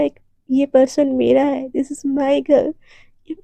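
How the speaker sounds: plain reading voice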